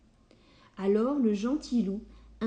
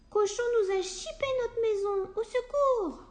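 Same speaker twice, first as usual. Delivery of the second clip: much higher, fast squawking hen voices; panicked hens; 13 semitones higher than usual. Acted. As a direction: cry for help as frightened chickens. Hens crying for help.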